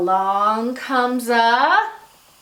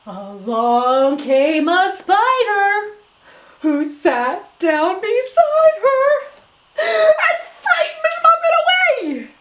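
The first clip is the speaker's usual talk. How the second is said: slow, building higher and louder into a sung spooky line; spooky spider voice; 7 semitones higher, 5 dB louder, slower than usual. Shown as a demonstration. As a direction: creep in slowly, then rise to a high scary wail. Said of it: "changing our voices to show what's happening".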